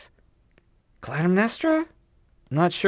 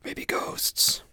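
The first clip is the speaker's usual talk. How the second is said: a frightened hushed whisper of Maybe ghosts; scared whisper; 6 dB quieter than usual. Retold or imitated. Quoting the movie character to his cohost, hushed whisper. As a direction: breathe Maybe ghosts with fear. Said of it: "And he was like".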